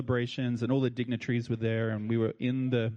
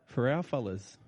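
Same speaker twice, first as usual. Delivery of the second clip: ordinary talk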